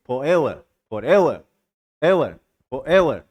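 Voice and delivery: "forever" with weight on EV; stress on middle syllable. Shown as a demonstration. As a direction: hit the EV in "forever", keep "for" light. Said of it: "ज्यादा stress लग रहा है".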